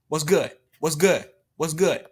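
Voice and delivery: mild cocky taunt thrown at the opponent. Real, not acted. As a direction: taunt the rival, repeating it.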